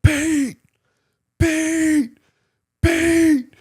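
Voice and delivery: very high, loud repeated hollers; yelling a name. Retold or imitated. Nick yelling down the hallway for Pete, loud high hollers. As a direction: call him out down the whole hallway. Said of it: "he's just yelling".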